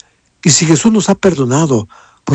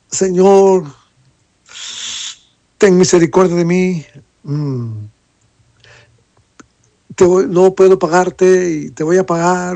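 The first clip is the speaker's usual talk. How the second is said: a slightly raised, begging plea; pleading; 3 semitones higher than usual. Retold or imitated. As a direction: beg the lord for mercy, a touch higher. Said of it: "le suplicó y le dijo". (súplica algo más aguda, pidiendo misericordia)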